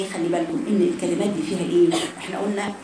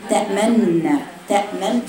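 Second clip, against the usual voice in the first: normal talking voice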